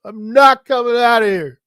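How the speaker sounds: put-on high, loud bratty little-kid yell; whiny kid brother yell. Little brother locked in the bathroom yelling back, bratty and loud. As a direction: scream it like a hurt little brother. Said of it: "And he's yelling".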